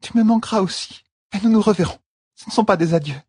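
high, reassuring farewell voice; comforting Elaine. A narrator's reading. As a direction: promise to meet again.

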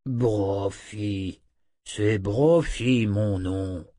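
very slow talking-wolf voice; loup parlant. Acted. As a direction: correct them, drawing out every word. (voix de loup, très lente et traînante)

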